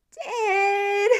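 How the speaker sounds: put-on high, very loud child's yell. A narrator's reading. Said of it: "yelled much louder this time".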